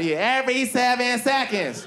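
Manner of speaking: high, insistent echo of a stage cry; high-pitched mimicked proclamation; mock yelled stats. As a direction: throw it out like a rallying chant. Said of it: "And she yells out stats".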